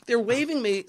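usual speaking voice

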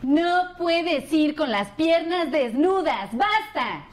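slow, scolding mother voice; imitating her mom. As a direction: scold like a strict mom, slowly. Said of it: "mi mamá habla así".

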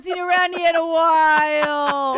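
put-on Queens accent. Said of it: "my Queen's accent".

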